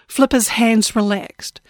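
ordinary delivery, nothing marked

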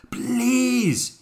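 high, loud exasperated shout. A narrator's reading. Albert shouting from under the hippo, exasperated shout. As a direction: shout it out in desperation. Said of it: "he shouted".

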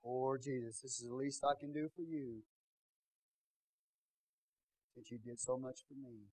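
hammed-up pity for poor Jesus; mock pitiful. Altar-goers pitying Jesus, soft mock-pitiful sigh. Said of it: "And they'll go stand in the altar".

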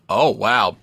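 ordinary delivery

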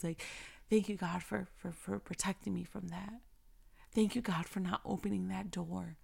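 soft, hushed prayer of thanks; quiet prayer. Pretend. Talking to God in thanks, soft and hushed. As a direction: pray it softly, close to a whisper. Talk to him and say.

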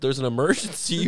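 usual speaking voice

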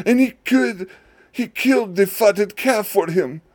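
high, pleading complaint; aggrieved son. Acted. Older son protesting to his father, high and aggrieved.

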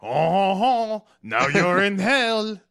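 teasing cohost in a silly devil voice; mock devil voice. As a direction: ham it up like a cartoon devil. Spooky mock voice.

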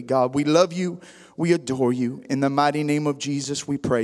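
low-pitched congregational prayer. Real, not acted. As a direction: lead the room in an earnest opening prayer.

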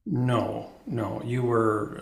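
normal talking voice

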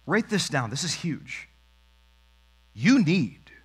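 usual speaking voice

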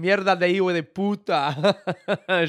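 laughing, mock Spanish swearing with a Colombian accent; imitating drunk Colombian. Imitating the drunk Colombian fan at the pub, laughing in a Colombian accent. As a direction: laugh through a loose Colombian accent impression. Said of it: "what was he saying".

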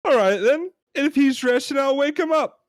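loud, high, exasperated British customer; hammed-up British outrage; British accent. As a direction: insist loudly in a put-on British accent. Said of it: "We're gonna try our British accents".

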